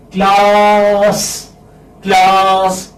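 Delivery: "Class" with the a drawn out long; prolonged vowel. Shown as a demonstration. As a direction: stretch the vowel of "Class" as a demo. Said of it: "Now this is a prolonged vowel sound".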